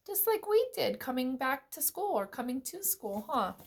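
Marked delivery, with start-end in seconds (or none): none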